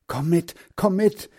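high-pitched urgent call. A narrator's reading. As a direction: call to a friend to follow. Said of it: "rief Heinz". (hoch und eindringlich gerufen)

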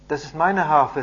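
firm claim. A narrator's reading. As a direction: speak up to claim what is yours.

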